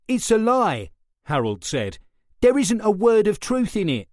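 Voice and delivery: high, loud protest; angry denial. A narrator's reading. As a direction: fling the accusation back. Suspect furiously denying.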